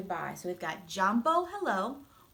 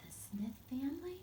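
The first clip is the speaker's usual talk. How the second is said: whisper; 5 semitones higher, 7 dB quieter than usual. A narrator's reading. Story narration builds suspense.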